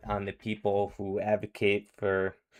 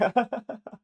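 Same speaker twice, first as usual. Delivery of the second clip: sudden high burst of real laughter. Real, not acted. Reactor laughs at clip insult.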